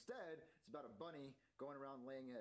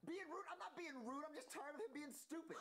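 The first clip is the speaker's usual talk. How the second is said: high, whiny, upset protest; indignant whine; 11 semitones higher than usual. Acted. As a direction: defend yourself in a teary huff. Mario argues back at Rosalina.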